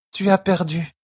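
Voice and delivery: she thinks he lost; disappointed young woman. Acted. Tuon disappointed.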